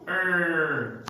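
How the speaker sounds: "er" held long on the R; drawn-out, emphasized R in "er"; stressed American R. Shown as a demonstration. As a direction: stretch "er" with a strong R. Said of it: "They stress in the R".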